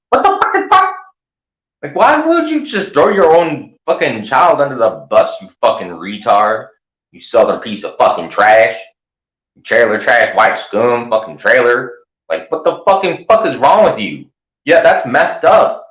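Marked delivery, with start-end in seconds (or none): 0.1-5.4 high, stunned what the fucking fuck; outraged disbelief. Real, not acted. Outraged at the accusation. 12.3-15.8 high, incredulous rant; much higher, slowed outrage; appalled. Real, not acted. Outrage continues.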